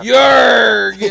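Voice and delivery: loud pirate yell; pirate shout. Pretend. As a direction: growl it like a pirate. Pirate joke.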